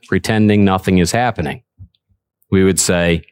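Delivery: ordinary talk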